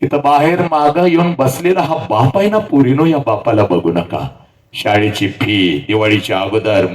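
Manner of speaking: ordinary talk, nothing marked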